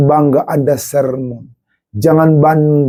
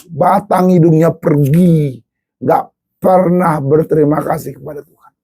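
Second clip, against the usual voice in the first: ordinary talk, nothing marked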